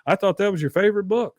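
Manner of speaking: lightly voiced Peggy grieving over the ruined book; Peggy dismayed. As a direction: ask it in hurt disbelief. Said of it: "she goes".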